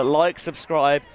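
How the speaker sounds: usual speaking voice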